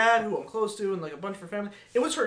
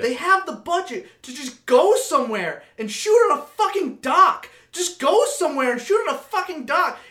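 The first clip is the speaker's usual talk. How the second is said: loud, high, exasperated ranting; angry rant; 7 semitones higher, 6 dB louder than usual. Real, not acted. Friend ranting about a bad movie scene, loud angry rant. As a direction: vent the frustration, voice climbing to a shout.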